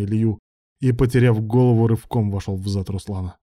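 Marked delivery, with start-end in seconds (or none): none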